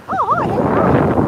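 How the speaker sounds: very high, mock-friendly hello; surprised greeting. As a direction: act out greeting a stranger who waves, voice shooting up. Acting her reply to waving strangers.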